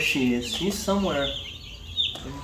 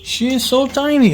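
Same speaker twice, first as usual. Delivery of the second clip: cooing; 10 semitones higher, 4 dB louder than usual. Real, not acted. Man admires a tiny chick, high cooing.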